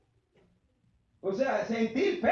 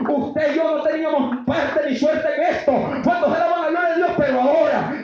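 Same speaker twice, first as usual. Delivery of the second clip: loud, raised preacher's shout; full-force preaching; 2 semitones higher, 7 dB louder than usual. Real, not acted. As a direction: preach it out at the top of your voice. (prédica exaltada a gritos)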